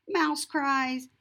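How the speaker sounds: ordinary delivery, nothing marked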